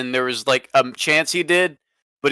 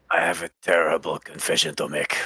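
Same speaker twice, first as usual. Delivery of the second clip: low, hushed and soft; quiet; 11 semitones lower, 6 dB quieter than usual. Acted. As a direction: lower your voice to a hush. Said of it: "You're trying to be quiet".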